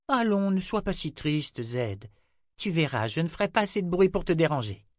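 put-on high-pitched child's voice; high girlish reassurance; little girl Rachel, consoling. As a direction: console an old friend as a small girl would.

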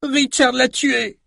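very high, drawn-out yell; screamed accusation. A narrator's reading. Samantha denounces Richard to the newcomer, high slow scream. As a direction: cry it out slowly at a very high pitch. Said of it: "hurla Samantha".

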